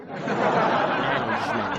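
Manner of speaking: Management said slowly with a soft dge; correct soft g. Shown as a demonstration. Teacher modelling the correct management for students. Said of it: "That is not the correct way".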